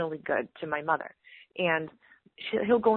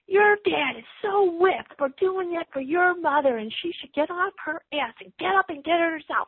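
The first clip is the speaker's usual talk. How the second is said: imitating mad husband; 9 semitones higher than usual. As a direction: complain in a raised, exasperated imitation. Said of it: "my husband gets so mad".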